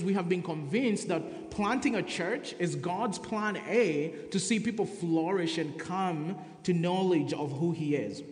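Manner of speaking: usual speaking voice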